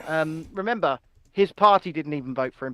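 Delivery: ordinary talk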